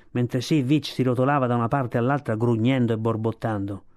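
plain reading voice

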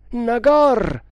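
high, loud drawn call; shouting. A narrator's reading. Tremal Naik calls again for missing Nagor, shouting.

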